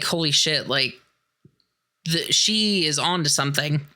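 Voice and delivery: ordinary talk, nothing marked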